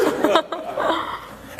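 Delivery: airy voiceless laughing; breathy laugh. Real, not acted. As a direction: let out a wheezing laugh. Still laughing.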